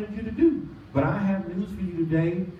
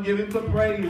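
ordinary talk, nothing marked

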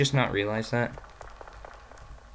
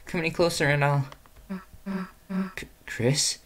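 character voice; 10 semitones higher than usual. Acted. Voicing a game character's lines.

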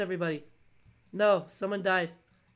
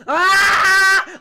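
very loud, high scream; piercing shriek of alarm; startled scream; 10 semitones higher, 11 dB louder than usual. Real, not acted. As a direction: scream in sudden fright. Startled by an attack in game.

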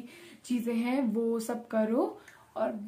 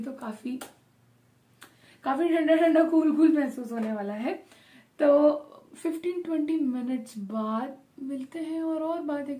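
face covered by sheet mask; wearing a sheet mask. Real, not acted. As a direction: lips framed by mask cutout. Seen 1: Wet sheet mask covers face around mouth throughout; absent in R frames.

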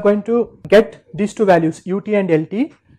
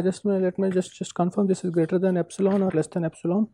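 quiet aside; 3 semitones lower, 13 dB quieter, faster than usual. Real, not acted. Aside while checking his notes.

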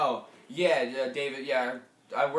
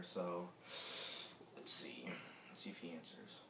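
hushed whisper while the phone rings; barely voiced, breathy whisper; 5 semitones lower, 13 dB quieter, slower than usual. Real, not acted.

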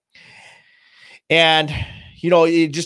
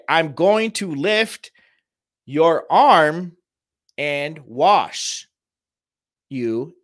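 calm, soothing; 3 semitones higher, much slower than usual. Shown as a demonstration. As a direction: talk a parent gently through each move. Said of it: "in a low, calm, soothing voice".

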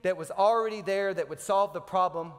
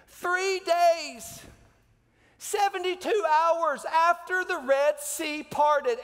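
building from high to much higher and louder, slow on key words; full-force preaching; 6 semitones higher, 3 dB louder than usual. Real, not acted. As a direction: preach it, climbing with each phrase.

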